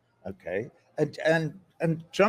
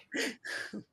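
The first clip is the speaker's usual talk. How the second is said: whispered laugh; 11 semitones higher, 10 dB quieter than usual. Real, not acted. Laugh after the punchline.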